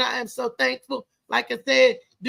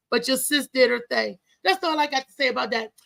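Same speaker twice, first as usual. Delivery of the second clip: normal talking voice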